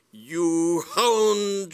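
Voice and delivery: high, loud, drawn-out accusation; indignant lady crying out at full voice; outraged cry. A narrator's reading. Lady Bassett confronting the book thief, outraged cry. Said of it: "she cried".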